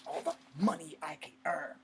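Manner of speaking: heated, voice rising loud at the end; heated denial. Acted. Soldier defending why he joined the army, heated. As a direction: build into a loud, scornful denial.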